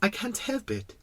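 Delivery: high, soft little-prince voice; embarrassed. Acted. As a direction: apologise in a small embarrassed child voice. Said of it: "quite embarrassed".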